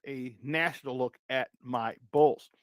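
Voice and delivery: ordinary talk, nothing marked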